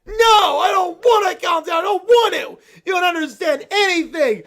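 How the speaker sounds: shouting tantrum. Acted. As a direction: scream it at her like a kid refusing. Bear tantrum at mom.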